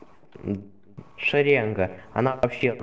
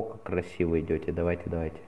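ordinary talk, nothing marked

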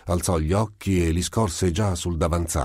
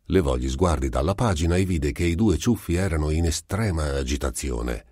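plain reading voice

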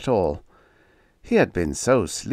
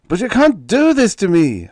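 much higher and louder, desperate; scream; 12 semitones higher, 9 dB louder than usual. Acted. Ruined writer screaming at his editor. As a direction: scream in panic. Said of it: "Harmer screamed".

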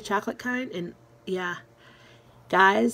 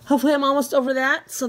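higher, louder, fast and bright; excited hopeful; 5 semitones higher, 5 dB louder, much faster than usual. Real, not acted. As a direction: rush it out with hope. Repeats hope phrase, brighter.

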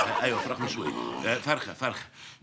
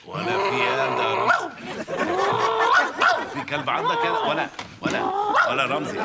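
shrill alarmed shout, much higher and louder; startled yelling; 5 dB louder than usual. Acted. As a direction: shriek it in sudden shock. Alarmed, friend suddenly barking.

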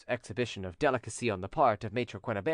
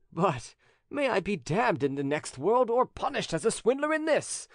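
lightly voiced, exasperated notary, pitched up; animated notary; 8 semitones higher than usual. Notary tells widow he cannot raise the money, animated exasperation. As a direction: protest like a notary short of money.

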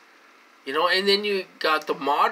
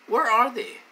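loud rant; 6 semitones higher, 4 dB louder than usual. Real, not acted. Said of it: "get on a rant and get loud".

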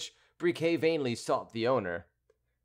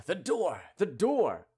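crowd crying out; 7 semitones higher, slower than usual. A narrator's reading. Annoyed riders shut out by closed doors, crying out. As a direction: call it out with all your might. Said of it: "cried with all their might".

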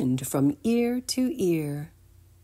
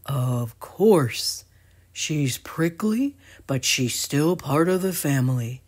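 gentle reminder; 2 semitones lower than usual. Acted. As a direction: remind them tenderly. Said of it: "Mike gently reminded them".

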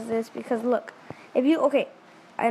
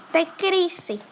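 higher, louder mock repeat of the phrase; mocking echo; 7 semitones higher, 4 dB louder than usual. Echoing the mom's advice back, higher and mocking. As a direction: parrot it back with a teasing lift.

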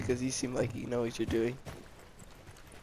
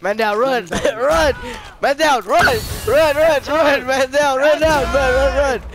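panicked shouting, much louder and higher; being chased; 10 semitones higher, 12 dB louder than usual. Real, not acted. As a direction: yell run while fleeing in panic. Fleeing after man down in game.

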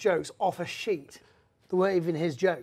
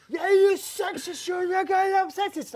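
high, slow mock drunk woman; drunk woman heckler; 12 semitones higher, 3 dB louder than usual. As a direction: drag it out like the heckler.

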